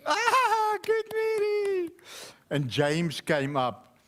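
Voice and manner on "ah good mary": much higher, slow, with a laugh; a teacher's bright praise for a child; teacher praising Mary, high and slow. Acted.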